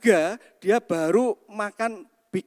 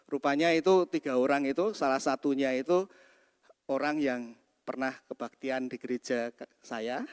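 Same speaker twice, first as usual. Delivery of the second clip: normal talking voice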